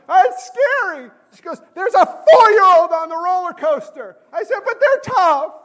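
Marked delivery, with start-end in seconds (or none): 0.1-1.1 high, loud frightened wail; comic crybaby squeal; mock scared whine. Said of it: "told me to shut up and quit crying". 1.7-4.2 high, loud mock exasperation; comic indignant yell; imitated scolding wife. 4.6-5.5 very high, loud comic whimper; hammed-up childish wail; mock crybaby whine. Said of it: "told me to shut up and quit crying".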